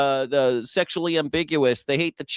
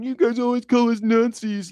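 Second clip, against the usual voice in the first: whiny mock complaint; 7 semitones higher than usual. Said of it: "you guys are like".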